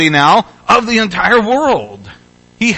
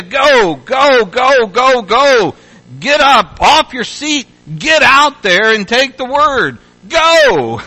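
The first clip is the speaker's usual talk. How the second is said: higher and louder urging, ending on a squeaked-high go; hammed-up urging; 6 semitones higher, 3 dB louder, slower than usual. Preacher parodying the push to go, rising to a high yelp. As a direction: pump up the crowd, mockingly. Caricature of go emphasis.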